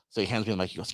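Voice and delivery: usual speaking voice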